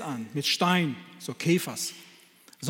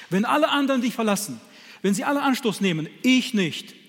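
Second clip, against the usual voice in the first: louder, higher and fast, full of self-confidence; boastful vow; 6 semitones higher, 5 dB louder than usual. Acted. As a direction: swagger through Peter's promise. Peter's boastful vow paraphrased. (großspuriger Schwur, lauter und schneller)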